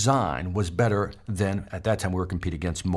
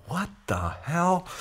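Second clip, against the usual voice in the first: stunned disbelief; 8 semitones higher, slower than usual. Retold or imitated. Disbelief after Roy's point.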